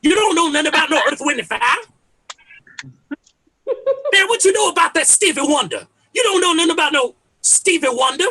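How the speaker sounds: loud, high, badgering old-head dad; obnoxious old head. Dad reminiscing about his music, loudly obnoxious. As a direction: holler it like a dad challenging the youngsters. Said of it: "but in an annoying, obnoxious way".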